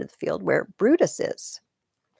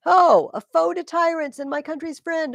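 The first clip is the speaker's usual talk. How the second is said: put-on young soldier roaring his name across the field; battle cry; 11 semitones higher, 8 dB louder than usual. Young Cato to the enemy, a defiant battle shout. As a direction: bellow it like a charge.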